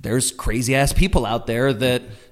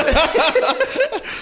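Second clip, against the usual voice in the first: squealing laugh, pitched way up; high burst of laughter. Real, not acted. Laughing high at his friend's dramatic text.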